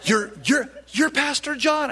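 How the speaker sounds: put-on an elderly woman recognizing her pastor, rising astonished voice; amazed surprise. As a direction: gasp and stammer in disbelief. Old lady meets the pastor she admires.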